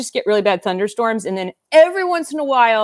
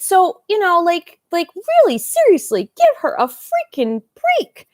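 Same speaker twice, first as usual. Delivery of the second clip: outraged; 10 semitones higher than usual. Real, not acted. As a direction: let the outrage climb high. Outraged defence of Simone Biles.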